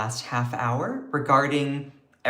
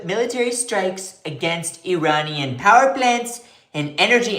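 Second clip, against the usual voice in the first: Trump impression; 3 semitones higher than usual. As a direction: puff up and proclaim like Trump. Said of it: "I can't do my Trump impression".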